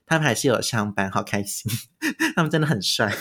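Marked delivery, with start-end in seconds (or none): 2.0-3.1 laughing. Real, not acted. Gushing about handsome guards.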